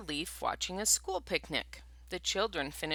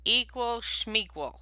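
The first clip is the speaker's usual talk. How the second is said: dismissive bear; 6 semitones higher, 4 dB louder than usual. Acted. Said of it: "said Bear".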